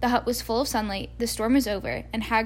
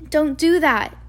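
sharp raised cry, higher and louder; shouting at the owl; 5 semitones higher, 5 dB louder, slower than usual. Acted. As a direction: snap it out to stop the owl.

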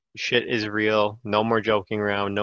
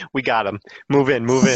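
mock SWAT command; 3 semitones higher, 6 dB louder than usual. Mock police raid.

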